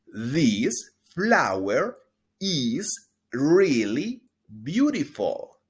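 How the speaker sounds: slow, loud, clear teacher model; drawn-out pronunciation model; slow model sentence. Shown as a demonstration. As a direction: stretch every word for repeating. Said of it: "Repita después de mí".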